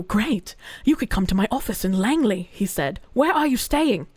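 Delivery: low, even reading voice; article reading. A narrator's reading. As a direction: read the story out steadily. Reads article aloud.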